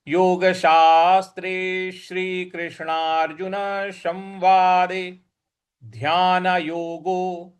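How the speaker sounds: chanted Sanskrit, slow and measured; slow recitation. Shown as a demonstration. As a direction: recite slowly and clearly. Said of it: "slow model recitation". (धीमा संस्कृत पाठ)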